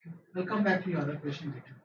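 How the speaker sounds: usual speaking voice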